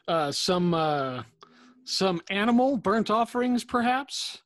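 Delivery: rising, uneasy query; hopeful guess. Acted. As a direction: offer the harmless option hopefully. Character guesses hopefully at milder sacrifice.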